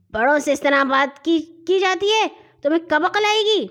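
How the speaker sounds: indignant telling-off; angry scolding. Acted. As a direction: scold a younger child sharply. Bully slaps and scolds Hashim.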